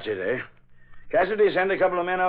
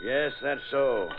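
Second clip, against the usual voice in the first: drawn out slowly, unbothered; slow deadpan retort; 2 semitones lower, much slower than usual. Acted. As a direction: make him wait for each word. Sergeant answers reporter's retort.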